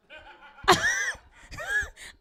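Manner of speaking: high, loud burst of laughter. Real, not acted. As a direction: crack up out loud at the crowd. Laughs at audience member's answer.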